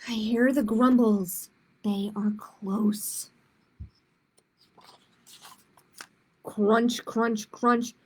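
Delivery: put-on reading to children, clear and lively; picture book read aloud; story narration. A narrator's reading. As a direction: tell it like storytime for kids.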